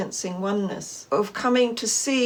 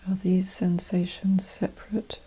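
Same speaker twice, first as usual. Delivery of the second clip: guided meditation; 2 semitones lower, 4 dB quieter than usual. Acted. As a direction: gently guide them inward, unhurried and even, leaving space after each phrase.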